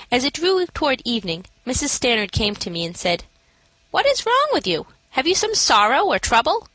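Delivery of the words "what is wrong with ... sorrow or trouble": high, concerned questioning; concerned matron. A narrator's reading. Matron asks the sad newcomer what troubles her, concerned. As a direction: ask her gently what is wrong.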